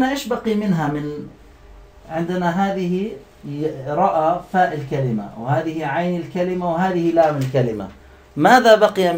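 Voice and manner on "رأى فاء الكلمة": ordinary delivery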